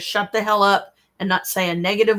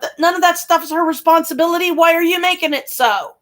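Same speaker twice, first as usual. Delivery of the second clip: higher, louder, fast angry outburst; exasperated rant; 8 semitones higher, 5 dB louder than usual. Real, not acted. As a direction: let the frustration boil over. Ranting at absent Michael.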